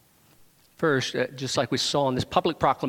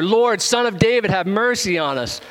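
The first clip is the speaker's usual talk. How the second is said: loud, raised cry for mercy; shouting out; 6 semitones higher, 6 dB louder than usual. Retold or imitated. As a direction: call out loudly over a crowd. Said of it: "they shouted all the louder".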